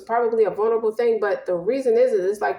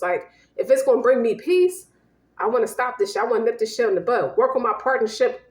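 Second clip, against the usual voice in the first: normal talking voice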